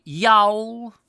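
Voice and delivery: Y'ALL whined high and loud in mock American; mock a lazy American, running Y'ALL into one loud whiny syllable; high, whiny put-on American accent. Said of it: "in that sort of whiny voice".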